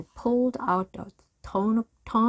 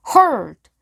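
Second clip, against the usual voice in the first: shouted; 6 semitones higher, 12 dB louder than usual. A narrator's reading. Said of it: "Captain Bones shouted".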